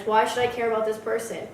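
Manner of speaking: usual speaking voice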